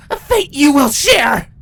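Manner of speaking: enraged captive queen yelling; shouted in rage. Acted. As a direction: scream the threat at her. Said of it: "Twilight shouted in rage".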